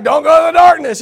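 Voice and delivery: high, loud warning shout; mock shout. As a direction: call out the warning at full volume. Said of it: "I'm like".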